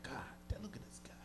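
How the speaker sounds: breathless admiring whisper; awed whisper. Retold or imitated. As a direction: gawk at the cop bike in a hush. Admiring cops' bikes.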